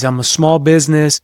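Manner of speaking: normal talking voice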